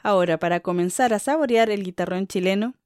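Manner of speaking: ordinary talk, nothing marked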